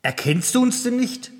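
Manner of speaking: high-pitched incredulous question; calling out questioningly. A narrator's reading. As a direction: raise the voice high in appeal. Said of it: "rief der Anführer". (mit hoher Stimme fragend gerufen)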